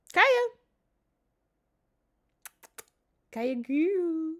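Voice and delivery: loud, high-pitched pet call; calling the cat. Real, not acted. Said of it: "I do this to get their attention".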